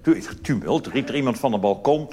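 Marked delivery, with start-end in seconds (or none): none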